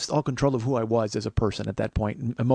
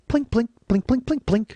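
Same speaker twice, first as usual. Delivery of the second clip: high, short "plink" drips; tiny high-pitched plink noises; imitated plinking; 10 semitones higher, 3 dB louder than usual. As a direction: mimic little drops, pitched up and evenly spaced. Imitates dripping sound.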